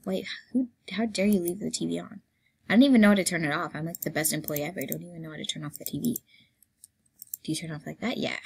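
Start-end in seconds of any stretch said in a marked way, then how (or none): 5.2-8.2 whispered question to herself; hushed puzzling. Real, not acted. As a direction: murmur it unsure, under your breath. Unsure about the TV.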